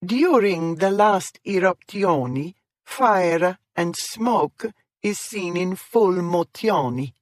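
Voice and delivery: over-the-top Italian guide voice; exaggerated Italian accent. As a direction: ham up the Italian accent, stretching every word. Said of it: "She rolled the R's and added extra vowels".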